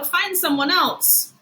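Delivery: lightly voiced curt schoolboy; dismissive kid. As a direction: shut him out. Emilio rebuffs Brian.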